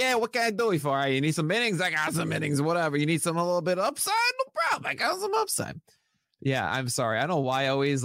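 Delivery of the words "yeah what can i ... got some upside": high-pitched, hammed-up Brooklyn hustler; terrible Brooklyn accent. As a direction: do a bad Brooklyn accent, pitch climbing as the pitch goes on. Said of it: "my terrible Brooklyn accent".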